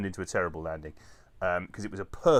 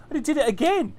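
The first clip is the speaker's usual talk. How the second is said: exasperated; 13 semitones higher, 5 dB louder than usual. Real, not acted. Exasperated retelling of glitch.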